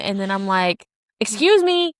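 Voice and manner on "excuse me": high, louder-pitched indignant call; startled challenge. Retold or imitated. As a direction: call out to an unexpected intruder. Startled challenge to intruder at door.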